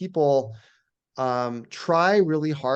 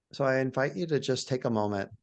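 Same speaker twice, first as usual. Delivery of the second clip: guided meditation; 2 semitones lower than usual. Shown as a demonstration. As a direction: gently guide listeners into awe, phrase by phrase. Leads a guided awe meditation.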